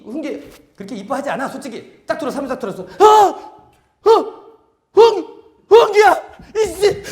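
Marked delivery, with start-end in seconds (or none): 3.0-7.0 high, loud, stretched delighted cry of the name; overjoyed greeting. Acted. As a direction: greet the kid like a long-lost friend. Master delighted to see child.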